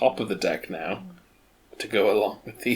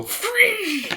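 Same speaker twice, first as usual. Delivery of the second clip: character cry. Acted. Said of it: "So I pick him up and go".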